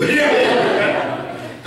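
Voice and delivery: real burst of laughter, falling away; loud high laugh. Real, not acted.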